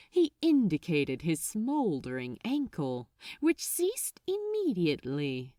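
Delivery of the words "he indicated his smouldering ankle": plain reading voice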